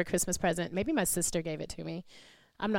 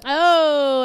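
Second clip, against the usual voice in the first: mock offended gasp; 6 semitones higher, 12 dB louder than usual. Real, not acted. Mock outrage at the joke.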